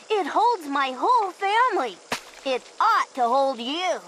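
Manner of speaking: ordinary delivery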